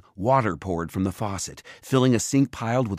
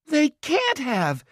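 much higher, slow squeaky disbelief; near squeak; slower than usual. A narrator's reading. Simon hears Mom was taken and squeaks in disbelief. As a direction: let the voice crack up into a squeak. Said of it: "close to a squeak".

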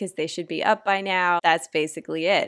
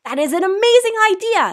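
much higher and louder, gushing with delight; over-the-top excited; 13 semitones higher, 9 dB louder than usual. Retold or imitated. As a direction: act wildly thrilled by the idea. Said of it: "And I was like".

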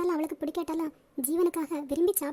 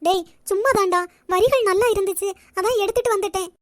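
loud, raised-pitch denial; defensive protest; 3 semitones higher, 6 dB louder than usual. Acted. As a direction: protest your innocence loudly to a sibling. Sister defends herself again.

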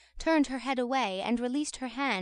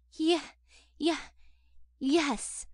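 halting, higher-pitched yes in broken attempts; sighed-out yes; 5 semitones higher, much slower than usual. A narrator's reading. Matron accepting the beadle's proposal, sighing it out haltingly. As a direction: falter twice, then sigh out the word. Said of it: "sighed out the matron".

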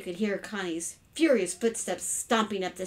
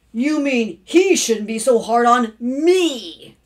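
loud, slow, indignant comeback; angry retort; 3 semitones higher, 10 dB louder, slower than usual. A narrator's reading. Father turning his wife's plea back on her, angry. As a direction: throw it back with heavy emphasis. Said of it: "said Mister Carmody angrily".

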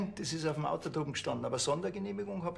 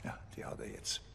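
whispered; 9 dB quieter than usual. Acted. Dry remark about the dead man.

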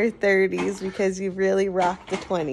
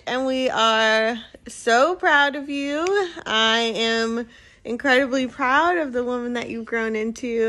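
ordinary talk

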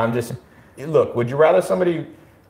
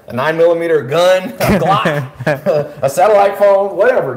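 loud, high, hammed-up pitch; hyped founder story; 3 semitones higher, 4 dB louder than usual. Hiker business owner pitches his company on video, hammed-up and loud. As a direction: ham up a rugged hiker selling his gear. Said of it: "Be like".

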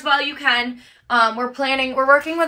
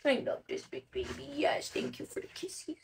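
unvoiced, much softer whisper to the dog; tender whisper; 15 dB quieter than usual. Real, not acted. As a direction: breathe it to the puppy.

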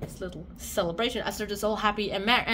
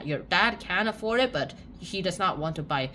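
usual speaking voice

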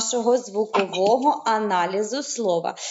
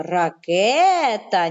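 ракета slow, stretched with the weight on ке; surprised stress; much slower than usual. Shown as a demonstration. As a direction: draw out ракета, amazed, hitting ке. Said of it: "дуже здивований". (здивовано протягнути ракета)

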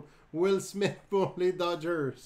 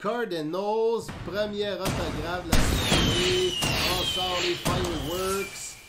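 louder, triumphant card reveal; excited hype; 2 semitones higher, 6 dB louder than usual. Real, not acted. Streamer revealing first autograph to viewers, loud and excited. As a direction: call it out like a celebration.

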